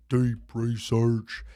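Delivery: loud slow catchphrase; slow trailer voice. Acted. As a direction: draw it out big. Catchphrase again.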